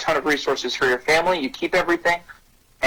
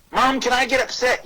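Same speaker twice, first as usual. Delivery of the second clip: lightly voiced child, higher pitch; a hint of a kid asking permission, pitched up; child asking mom; 10 semitones higher than usual. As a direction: raise the pitch for an eager kid's question. Acts out child asking parent.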